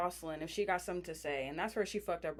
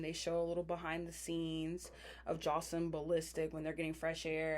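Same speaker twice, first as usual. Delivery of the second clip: usual speaking voice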